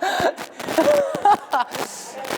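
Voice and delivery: high-pitched laughing giggle. Real, not acted. Laughing at the cramp story.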